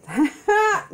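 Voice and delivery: sudden high, loud laugh; high burst of laughter. Real, not acted. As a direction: break into a squealing laugh. Laughing at her own story.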